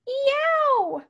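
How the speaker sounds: very high scalded wolf scream; yelp of pain. A narrator's reading. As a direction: yowl out, long and high. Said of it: "leaped up with a scream".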